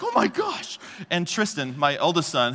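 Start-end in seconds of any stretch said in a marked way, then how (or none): 0.0-0.8 high shocked gasp of a father; horrified. Retold or imitated. Father seeing toddler with knife, high horrified gasp. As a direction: blurt it out, far higher, in alarm.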